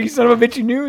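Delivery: mock-furious accusation; mock outrage. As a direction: shout it at the meter guy in fake fury. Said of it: "I was like".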